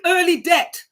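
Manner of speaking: Jamaican accent, death said like debt. Real, not acted. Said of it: "my Jamaican accent is coming out there".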